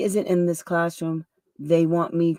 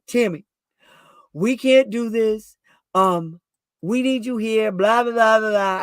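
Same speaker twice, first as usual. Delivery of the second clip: higher, drawn-out parents' plea; mock parental pleading; 3 semitones higher, 4 dB louder, slower than usual. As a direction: imitate pleading parents, stretching it out. Parents pleading, acted in retelling.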